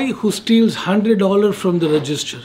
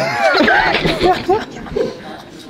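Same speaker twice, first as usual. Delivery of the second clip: high, drawn-out laugh. Real, not acted. Reacting to a tough riddle, high-pitched laughing.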